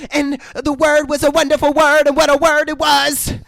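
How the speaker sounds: high, loud, taunting recitation; mocking possessed voice. Possessed girl mocking the priest's scripture, high loud taunt. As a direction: parody the scripture in a high demonic voice.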